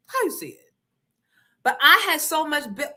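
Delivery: ordinary delivery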